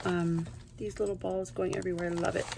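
normal talking voice

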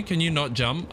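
ordinary delivery

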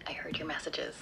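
hushed whisper. Acted. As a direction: keep it secret, barely voiced. Secret call behind his back.

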